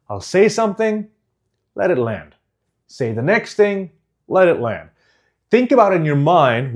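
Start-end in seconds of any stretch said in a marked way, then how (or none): 0.1-4.9 a hint of a pause after "something" and after "land"; slight pauses. Shown as a demonstration. Coach showing students pauses, "let it land" set apart by slight breaks. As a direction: lift "Say the next thing", pause, drop on "let it land". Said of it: "just a hint of a pause".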